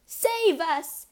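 high, loud scream for rescue; panicked cry. A narrator's reading. Mother terrified of the ghost, panicked cry. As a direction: cry out in terror. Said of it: "the mother".

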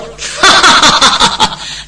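breathy falling snicker; mocking laugh. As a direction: laugh him off. 角色冷笑. (轻蔑的笑)

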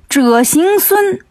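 high, drawn-out name call. Acted. Demon in mid-air calls Wukong's name, high slow call. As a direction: stretch each syllable high, like calling from afar. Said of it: "喊".

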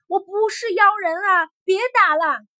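loud, high cry of protest; yelling. A narrator's reading. Prisoner yelling to the warriors beating him. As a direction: plead at the top of the voice. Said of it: "嘴里还嚷".

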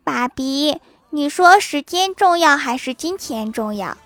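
put-on child asking dad. Child doing homework asks dad, playing innocent. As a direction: put on a coaxing little-kid voice. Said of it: "突然问郭大侠说".

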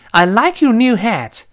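high, loud admiring line; mock admiration. Shown as a demonstration. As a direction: gush over the hat. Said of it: "表示是赞赏".

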